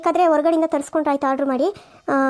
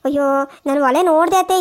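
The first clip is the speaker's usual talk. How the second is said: usual speaking voice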